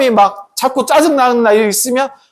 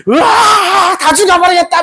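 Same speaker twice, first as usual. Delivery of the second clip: high acted roar of fury; hammed-up furious yell; explosive rage; 9 semitones higher, slower than usual. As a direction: erupt like a volcano, pitch flung up. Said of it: "분노의 폭발이 돼서 막 화산처럼".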